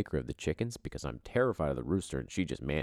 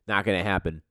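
ordinary talk

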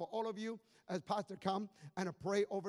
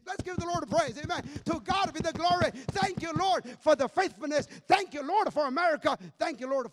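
loud praise; 6 semitones higher, 8 dB louder than usual. Real, not acted. Praising God loudly in church.

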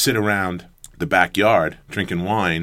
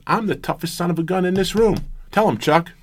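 high-pitched imitation of a coach's boast; 11 semitones higher than usual. Coach boasts to team in locker room, high put-on voice.

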